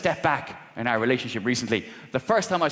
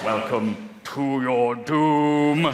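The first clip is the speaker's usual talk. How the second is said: villain cat voice; much slower than usual. Acted.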